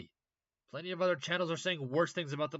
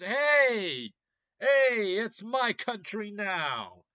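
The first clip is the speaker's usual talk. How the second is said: mock militant announcement; 6 semitones higher, 8 dB louder, much slower than usual. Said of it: "HTS decides".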